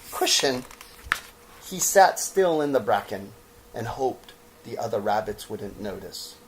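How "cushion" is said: usual speaking voice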